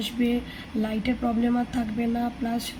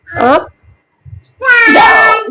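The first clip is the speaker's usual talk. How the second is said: sharp called command; 10 semitones higher, 16 dB louder, much slower than usual. Real, not acted. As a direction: snap out the move like a coach. Calling yoga moves to child. (বাচ্চাকে জোরে ডাকা down)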